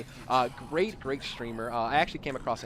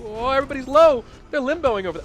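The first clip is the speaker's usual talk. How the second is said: excited; 11 semitones higher, 11 dB louder than usual. Real, not acted. Tense moment in the fight.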